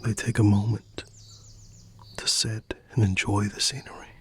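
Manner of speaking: soft unvoiced storytelling whisper; ASMR bedtime story whisper; whispered narration. A narrator's reading. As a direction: breathe the story out gently.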